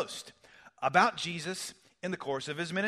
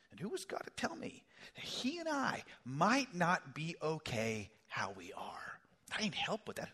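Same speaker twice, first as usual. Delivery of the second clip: whispered confession; 6 dB quieter than usual. Real, not acted. Preacher whispering the heart's defiant question to God. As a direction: breathe it out like a private admission.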